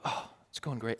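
a quiet mock "all good" dodge; breezy deflection. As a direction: shrug the question away. Mock dialogue, deflecting answer.

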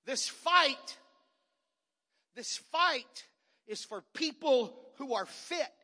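loud, slow pulpit proclamation; preacher proclaiming loudly; preached declaration. Acted. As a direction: hammer the point home.

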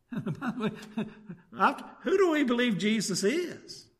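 ordinary talk, nothing marked

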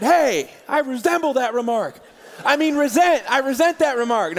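mock indignation. Retort to the trash talker.

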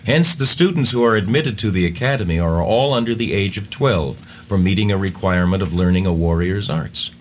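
ordinary talk

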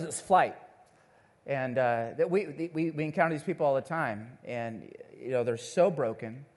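ordinary talk

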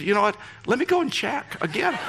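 high, mock-nervous retelling. Stewardess offering to check again, mock nervous. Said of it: "she got so nervous".

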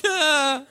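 high, drawn-out mock-terrified "ah"; comic yelp of fear; nervous squeal. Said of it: "I'm like".

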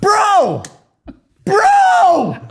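high yelled bro; shouting. Retold or imitated. Shouting at the big guy blocking the dance. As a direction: yell to get his attention.

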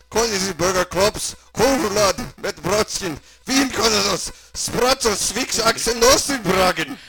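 put-on loud, high German-accented character; grandly welcoming German doctor, building through the dish list; hammed-up German host; German mad doctor.

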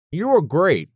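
mumbling through a mouthful; mouth full. A narrator's reading. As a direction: talk around a bite of pizza. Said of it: "mumbled through a mouthful of pizza".

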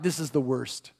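low, hushed aside of despair; dropped voice, privately defeated; inward dread. Retold or imitated. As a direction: quietly think it aloud, sinking. Husband's dread-filled thought, dropped voice.

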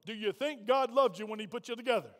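usual speaking voice

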